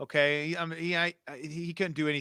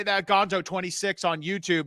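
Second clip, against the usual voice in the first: ordinary talk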